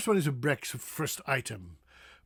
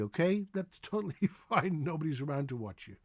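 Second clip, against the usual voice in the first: ordinary talk, nothing marked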